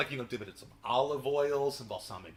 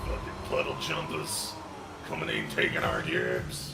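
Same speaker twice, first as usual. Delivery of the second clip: low, rough whispered grumble; a bigoted local sneering at outsiders; grumbling about frog people in a put-on gruff voice; gruff mock accent. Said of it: "I don't know what fucking accent that was".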